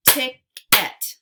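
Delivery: Ticket as loud "tick... et"; split into syllables. Shown as a demonstration. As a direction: punch out both halves of Ticket separately. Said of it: "give you two parts to a word".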